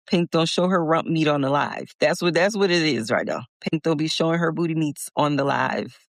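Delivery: ordinary delivery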